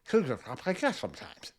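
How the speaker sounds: "Sometimes" drawn out with a thick back-of-mouth tongue; fat-tongued lisp. Shown as a demonstration. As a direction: slowly slur "Sometimes" from the back of the throat. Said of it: "make your tongue fat in the back of your mouth".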